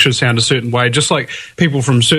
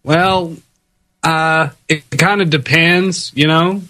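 American accent. Shown as a demonstration. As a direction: put on a drawling American accent. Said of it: "let's hear you do Ameri".

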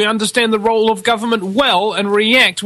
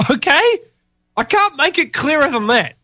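ordinary talk, nothing marked

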